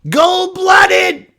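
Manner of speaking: high shouted chant; hammed-up stadium yell; mock fan shout. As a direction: bellow it like a rowdy fan. Mocking fans' chant.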